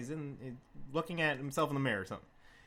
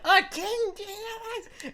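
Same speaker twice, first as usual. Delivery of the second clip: put-on drunken shout; 4 dB louder than usual. Drunk friend keeps shouting.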